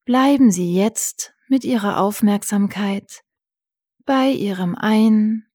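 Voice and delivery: calm guided breathing; soothing spa whisper. Acted.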